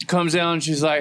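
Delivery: ordinary talk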